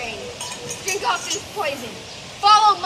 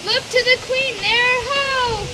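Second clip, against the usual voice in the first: alarmed shout; 6 semitones higher, 3 dB louder than usual. Acted. Calling out in alarm.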